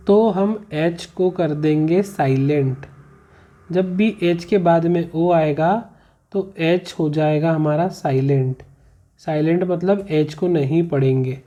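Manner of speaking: normal talking voice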